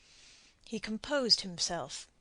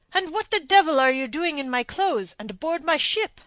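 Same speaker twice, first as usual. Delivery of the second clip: louder and higher, indignant shout; outraged; 7 semitones higher, 9 dB louder than usual. A narrator's reading. Don shouts at the man on his ship, outraged. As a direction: rage at the man in your clothes.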